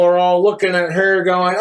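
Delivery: normal talking voice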